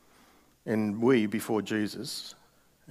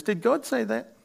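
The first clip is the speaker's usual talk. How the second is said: sly serpent; 13 semitones higher, 4 dB louder than usual. Retold or imitated. Serpent tempting Eve in Genesis.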